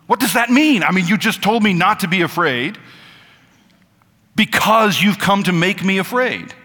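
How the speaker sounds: baffled protest. Acted. Confused hearer objects to being told not to fear, baffled protest. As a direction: push back in exaggerated bewilderment.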